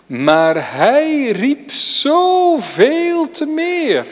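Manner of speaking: very slow, high and loud, stretching zoveel; drawn-out cry. Acted. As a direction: stretch each word as a long call. Preacher echoing Bartimaeus' crying.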